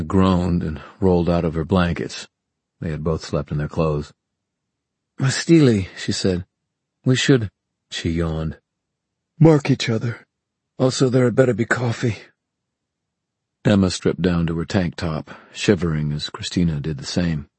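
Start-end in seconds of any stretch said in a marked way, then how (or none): 5.2-12.2 higher, lightly voiced young woman; a sleepy, grumbling friend; Christina's voice. A narrator's reading.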